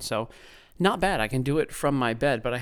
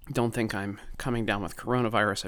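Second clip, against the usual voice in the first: ordinary talk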